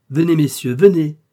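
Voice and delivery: ringing public invitation; speaking aloud. A narrator's reading. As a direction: summon them for all to hear. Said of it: "dit tout haut D'Artagnan".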